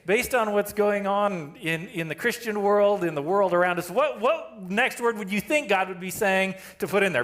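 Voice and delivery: ordinary talk, nothing marked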